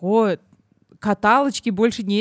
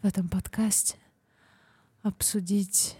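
erotic ASMR whisper; 5 dB quieter than usual. Pretend. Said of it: "А С М Р".

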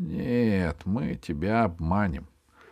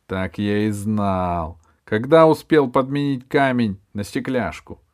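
loud angry rat boss; rat man; 2 semitones higher, 7 dB louder than usual. A narrator's reading. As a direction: snarl it like a pirate rat. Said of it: "потом голос крыса".

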